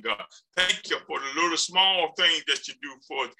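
fervent spoken prayer. Real, not acted. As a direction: pray aloud to the congregation.

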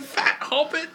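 high creature sneer; mock Gollum insult. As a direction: squeal it like Gollum. Listener voices Gollum line.

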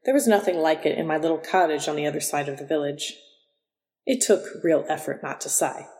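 usual speaking voice

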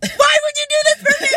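high, loud, half laughing, half crying as he pleads; cry-shout plea. Retold or imitated. Retelling his plea to his ex, breaking into a loud laughing cry.

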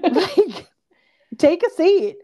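high, sassy put-down; dismissive. Retold or imitated. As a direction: tell them to sit down, sharply. Emma dismissing the boys.

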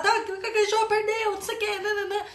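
high, loud schoolyard jeer; mocking taunt. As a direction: crow over the loser in a high singsong. Zoação de briga na escola. (provocação zombeteira, voz aguda)

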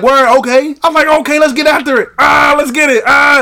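loud, high psych-up yell; retelling a gym hype yell, high and loud; hype shout. Retold or imitated. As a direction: pump yourself up before the lift. Retells hyping himself up.

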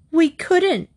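high-pitched outburst of protest, just short of shouting; near shout. A narrator's reading. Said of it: "Trixie almost shouted".

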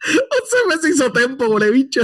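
words squeezed out through laughter; cracking up mid-sentence; laughing. Real, not acted. Cracking up at friend's math.

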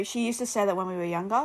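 usual speaking voice

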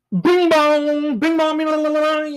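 high sung bing bong like a doorbell chime; doorbell imitation. Ringing the neighbor's doorbell at three am, high chime.

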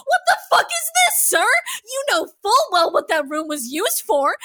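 high, loud, incredulous tirade; angry rant. Real, not acted. Host scolding Gideon over the screens line, angry rant. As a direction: yell mocking questions in outrage. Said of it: "I'm angry".

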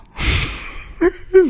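breathy, high giggling burst; helpless laughter. Real, not acted. Friend cracking up at his own band joke, helpless laughter.

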